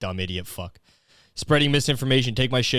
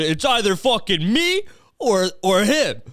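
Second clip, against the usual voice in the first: high, slow, whiny mock ultimatum; hammed-up high mock demand; 7 semitones higher, slower than usual. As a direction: play the sulky one demanding a choice. Mocking an ultimatum giver.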